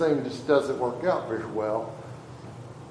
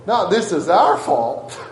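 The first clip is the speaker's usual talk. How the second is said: mock quote with a laugh; 2 semitones higher, 7 dB louder, slower than usual. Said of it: "She said".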